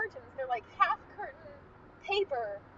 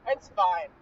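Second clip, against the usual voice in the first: low, loud brush-off; retold husband; 10 semitones lower, 6 dB louder than usual. Husband brushing off his wife's advice, low and firm. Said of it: "he's like".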